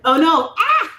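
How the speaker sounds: loud, high panicked yelp; real dismay. Real, not acted. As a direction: caught out and horrified. Realizes she mispronounced name.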